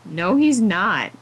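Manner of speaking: ordinary delivery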